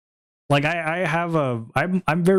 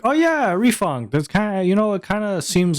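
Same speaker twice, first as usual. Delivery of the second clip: much higher voice imitating a friend; quoted friend; 8 semitones higher than usual. Streamer retelling a friend's game tip, high imitated voice. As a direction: pitch it up as the retold pal.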